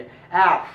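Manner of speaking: एफ with air escaping, lips apart; open-lipped f sound; English f. Shown as a demonstration. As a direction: keep air flowing through एफ. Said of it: "हवा निकलते रहनी चाहिए".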